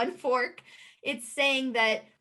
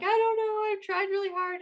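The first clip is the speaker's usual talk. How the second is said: high, whiny mock-crying voice; retold tearful protest, pitched way up; imitating near-tears; 10 semitones higher than usual. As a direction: plead as if almost crying, acted out. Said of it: "almost crying".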